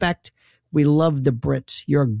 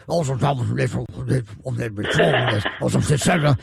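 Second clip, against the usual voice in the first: mock mumbled English; 5 semitones lower than usual. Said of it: "Only you can say".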